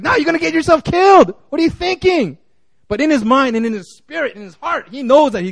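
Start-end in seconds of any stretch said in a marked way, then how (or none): 0.0-2.4 higher, pleading wife's voice; worried wife. Retold or imitated. Pastor voicing the worried wife, higher plea. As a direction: plead with him not to fight. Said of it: "he's like".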